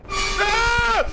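short high yelp; startled yell. Real, not acted. Caught off guard by the demon, startled yelp. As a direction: yelp in fright.

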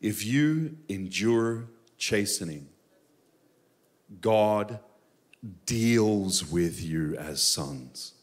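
very slow, dwelling on every word; slow reading. Shown as a demonstration. Pastor reading the verse slowly to the congregation. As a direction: read it slowly so it sinks in. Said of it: "We're gonna read this slowly".

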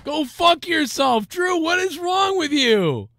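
can't believe what he just heard; stunned outrage. Real, not acted. Reacting in disbelief to clip.

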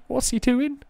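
high pitched baffled question; baffled disbelief. Real, not acted. Gamer baffled by Triple H's move, high disbelief. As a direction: squeak it out in disbelief.

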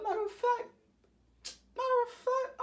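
very high squeaky aside; comic falsetto boast; cocky sudden idea. Retold or imitated. Bragging as a new idea strikes.

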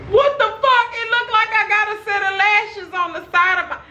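high disbelieving outcry; stunned at the mirror; shocked. Real, not acted. Shocked at the makeup.

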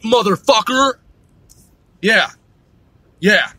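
ordinary talk

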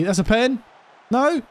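high-pitched disbelieving claim; incredulous appeal. Real, not acted. Gamer to the referee over a penalty, incredulous. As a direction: shoot the pitch up, demanding the penalty.

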